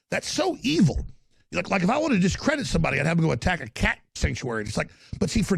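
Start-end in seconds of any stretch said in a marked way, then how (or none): 0.1-1.0 mock shock. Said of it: "you're like".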